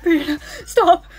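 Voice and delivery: loud, half-sobbing, half-laughing; laughing through tears at her own reflection; laughing and crying. Acted. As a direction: fight the giggles as you plead. Scolding herself while laughing.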